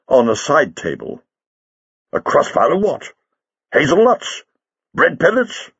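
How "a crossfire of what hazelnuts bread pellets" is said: incredulous mocking. Doctor pokes fun at Leela's plan, incredulous. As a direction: pitch climbing with each question.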